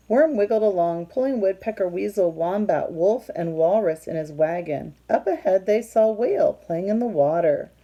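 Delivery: loud, lively storybook read-aloud; reading to children. A narrator's reading. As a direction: read it out big for the kids. Reading picture book aloud.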